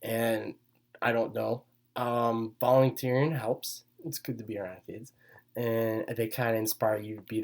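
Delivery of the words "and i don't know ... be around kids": normal talking voice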